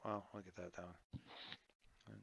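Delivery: normal talking voice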